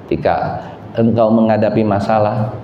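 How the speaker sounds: ordinary talk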